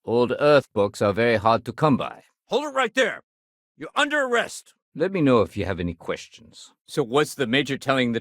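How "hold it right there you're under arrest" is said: high, urgent police challenge; calling out an arrest. Acted. Officer ordering a suspect to halt, urgent call.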